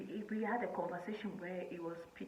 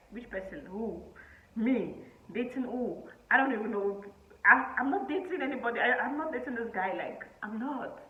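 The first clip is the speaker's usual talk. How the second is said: confused denial; 4 semitones higher, 8 dB louder than usual. Retold or imitated. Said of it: "I was like".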